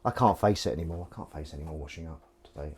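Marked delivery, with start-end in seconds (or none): 1.1-2.7 defeated mutter. Real, not acted. Tired mutter after finishing.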